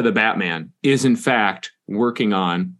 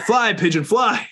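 dramatic farewell; 10 semitones higher, slower than usual. Pretend. Sending the pigeon off.

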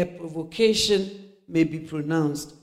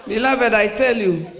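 usual speaking voice

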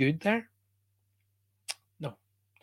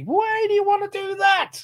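much higher, louder squeaky mock voice, poking fun; mock whiny question; 4 dB louder than usual. Teacher mocks the negative-energy crowd in a squeaky, much higher voice. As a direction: mimic someone who chooses negativity, in a silly pitch.

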